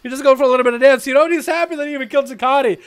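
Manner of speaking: excited esports caster calling the duel; hyped duel commentary; live play-by-play. Acted. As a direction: call the fight live as it happens.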